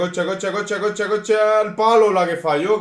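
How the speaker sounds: high, loud rising chant; excited shout. Real, not acted. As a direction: build up to the big miss. Near miss in the game.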